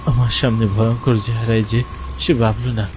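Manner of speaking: plain reading voice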